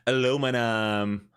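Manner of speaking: slow, drawn-out American "Aluminum"; mock American accent. Teasing Americans, slow American "Aluminum". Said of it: "You say".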